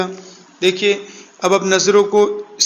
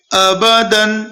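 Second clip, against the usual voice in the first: ordinary delivery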